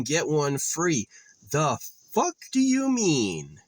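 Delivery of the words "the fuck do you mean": higher, exasperated snap; outraged disbelief. Real, not acted. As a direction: voice the disbelief at the sign. Outraged at nonsensical sale sign.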